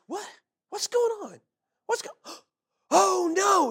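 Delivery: high, gasping alarm; panicked captain. Retold or imitated. Captain realizing the plugs are out, high alarmed voice. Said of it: "all of a sudden he goes".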